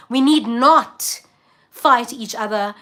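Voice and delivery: slow down and lean hard on "need not"; emphatic slow plea. Real, not acted. Urging fellow Africans to stop fighting, slow emphatic plea. Pleading for unity.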